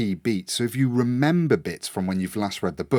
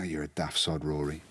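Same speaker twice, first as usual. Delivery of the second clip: low mock insult; 6 semitones lower, 9 dB quieter than usual. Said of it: "you just go".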